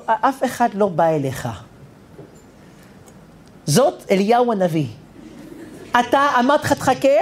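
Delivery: usual speaking voice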